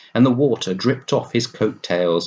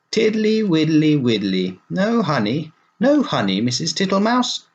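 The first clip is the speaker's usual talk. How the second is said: high, sing-song toad voice; sing-song grumble searching the pantry; Mister Jackson's voice; 8 semitones higher, 3 dB louder than usual. Acted. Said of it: "in a fat voice".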